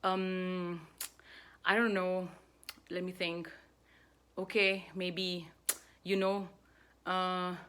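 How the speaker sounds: hesitant fillers with long gaps between; nervous buffering. Shown as a demonstration. Speaker mimics nervous speakers' buffers, hesitant. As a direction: stall for time, dragging out each filler. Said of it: "default buffer we use when we are nervous".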